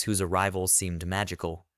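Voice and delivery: ordinary delivery, nothing marked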